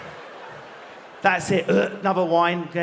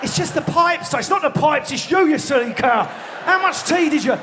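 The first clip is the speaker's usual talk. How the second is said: high-pitched mock indignation; acted bickering; 8 semitones higher, 3 dB louder than usual. Couple arguing over a gurgling tea belly, indignant bickering. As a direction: squabble in a high, accusing shout.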